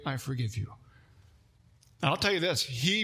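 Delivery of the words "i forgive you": soft, low "I forgive you"; a teacher quietly forgives a student, gently; gentle forgiveness. Retold or imitated. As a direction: say it softly and sincerely. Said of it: "look me in the eye and say".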